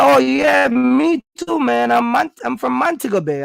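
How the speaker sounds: high, bright mock excitement of the examiner; excited reveal. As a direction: imitate the examiner gleefully letting slip he's Jamaican.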